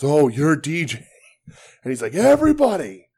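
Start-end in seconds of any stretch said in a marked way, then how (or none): 0.0-1.1 loud, slow, pointed realization; mock recognition. Wrestler finding out the fan's name, loud mock recognition. 2.1-3.1 high, loud mock announcement; imitated calling out. Wrestler calling everybody in the line, imitated loud summons. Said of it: "called everybody in the line".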